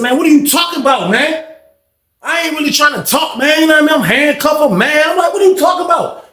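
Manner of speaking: high, agitated pleading in protest; cornered inmate snapping back at the guard; heated protest. Retold or imitated. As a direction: push back hard, voice climbing. Handcuffed, arguing with the CO.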